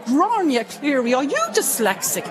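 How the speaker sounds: nun. Retold or imitated. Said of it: "one of the nuns said".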